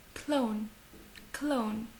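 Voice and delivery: CLOWN said softly the English way, "klown"; soft model of "clown", as in English; English pronunciation. Shown as a demonstration. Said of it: "comme l'anglais".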